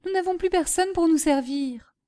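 put-on high-pitched, lightly plaintive girl; worried child. Daughter fretting that nobody serves them, worried. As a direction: point out the problem to mother anxiously.

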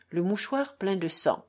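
ordinary delivery

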